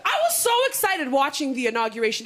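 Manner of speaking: ordinary delivery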